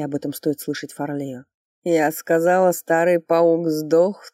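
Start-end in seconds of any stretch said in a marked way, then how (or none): 1.8-2.7 put-on drunk woman calling out loud; slurred, defiant tipsy woman; пьяный женский голос. Пьяная баронесса отвечает из кресла. 2.7-4.3 put-on slow drunken drawl on "паук". Первый раз фраза.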